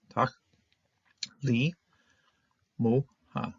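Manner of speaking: Tach, li, mu, ha with a pause after each; syllable by syllable. Shown as a demonstration. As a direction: count out the syllables one at a time. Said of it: "closed syllable, open syllable".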